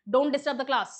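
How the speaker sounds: loud, stern classroom order; firm command. Shown as a demonstration. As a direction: lay down the rule firmly and loudly. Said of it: "आपकी tone firm है, कठोर tone है".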